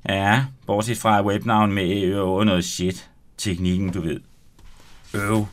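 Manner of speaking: lively read-aloud of a youth novel, voicing the boys; story narration. Author reading the opening roulade scene to the host, lively narration. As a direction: read the chapter out to the radio host with energy.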